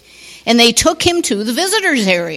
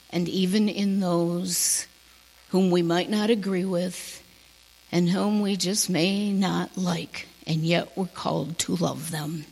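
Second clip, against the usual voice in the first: soft and quiet; 4 semitones lower, 8 dB quieter than usual. Real, not acted. Soft hushed ending of sermon.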